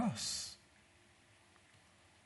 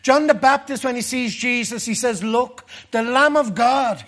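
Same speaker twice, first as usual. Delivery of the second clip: loud, fervent preaching, pitched higher; preaching at full force; 4 semitones higher, 6 dB louder than usual. Acted. Preacher to his congregation, loud and fervent. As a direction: build the fire of a revival sermon.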